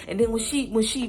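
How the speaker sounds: normal talking voice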